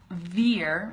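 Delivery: "veer" with a buzzing V; careful, isolated "veer"; V sound. Shown as a demonstration. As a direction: say "veer" with teeth on bottom lip. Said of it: "And then the V sound".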